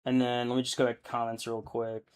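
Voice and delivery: ordinary delivery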